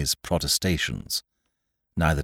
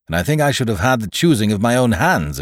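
higher, louder, insistent; a touch of a complaining captain; lightly voiced blunt captain; 7 semitones higher, 9 dB louder than usual. Captain objecting to the choice of hands, lightly voiced and insistent.

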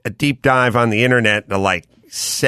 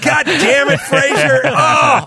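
high, loud disgusted yell at Fraser; mock outraged shout, pitched way up; exasperated outcry; 3 dB louder, slower than usual. Crowd reacts to colossal fart.

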